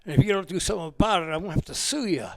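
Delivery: be the upset Alcatraz warden dad; angry dad on phone. Retold or imitated. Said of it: "He called me up and he says".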